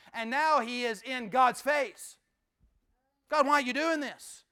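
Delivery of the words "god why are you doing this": loud, higher, accusing complaint; angry Jonah. Acted. Jonah quarreling in God's face, loud and angry. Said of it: "He is actually out of anger".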